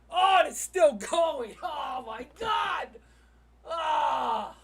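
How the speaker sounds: loud, high, stretched-out shout; real worked-up yelling; excited yelling. Real, not acted. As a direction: shout in disbelief as it keeps going. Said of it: "Sorry for yelling".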